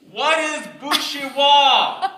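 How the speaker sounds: asking her father loudly and slowly; loud to a deaf dad. Retold or imitated. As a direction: make a hard-of-hearing father hear. Hard of hearing.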